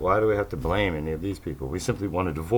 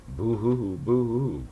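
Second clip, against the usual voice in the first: mock crying; 4 semitones higher than usual. Mocking opponents as crybabies.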